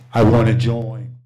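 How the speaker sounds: low, slow, loud I wanna join; dramatic declaration. Retold or imitated. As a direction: drop it down like a vow. Punchline after excited build.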